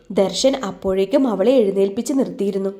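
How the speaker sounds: plain reading voice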